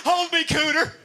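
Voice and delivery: high, loud, wailing plea; hammed-up tearful hillbilly; distraught Texan.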